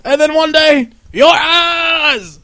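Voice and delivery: high loud villain shout; booming demon lord. Acted. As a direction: shout the threat like a tyrant. Quoting Zod, shouting.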